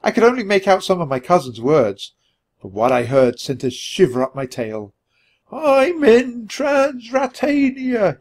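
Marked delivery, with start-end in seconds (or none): 5.5-8.2 very high, very slow squeak; high, slow frightened squeak down a bad line. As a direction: squeak it out from far away. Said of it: "he squeaked".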